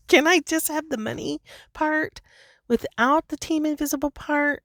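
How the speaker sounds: high, whiny plea; pleading. Retold or imitated. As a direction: beg in a high, wheedling voice. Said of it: "That's kinda how I feel".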